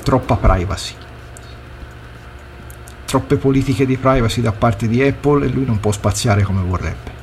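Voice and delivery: ordinary delivery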